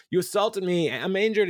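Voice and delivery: high-pitched mock victim; pretending to be injured. Podcaster retelling Tom accusing Jeremy of assault, mock hurt. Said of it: "pretending to be injured".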